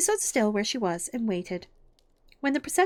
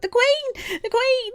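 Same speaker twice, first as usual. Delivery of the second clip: high, loud herald's cry; called out; 7 dB louder, slower than usual. A narrator's reading. Announcing the Queen's arrival, called out high and loud. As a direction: call it out across a garden, pitched way up. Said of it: "called out".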